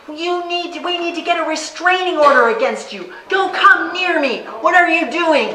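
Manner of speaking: high, loud, furious outburst; furious legislator. Retold or imitated. Legislator yelling at her in the hallway, furious and loud. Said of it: "he was furious".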